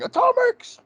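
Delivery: very high-pitched mock battle cry; Patrick Stewart cry. Fan imitates his dream text tone, high mock cry. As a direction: yelp it like an over-the-top actor. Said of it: "it's just Patrick Stewart going".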